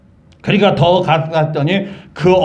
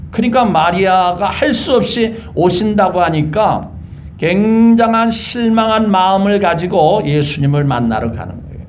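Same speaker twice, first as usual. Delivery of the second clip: ordinary delivery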